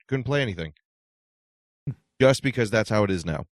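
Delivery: normal talking voice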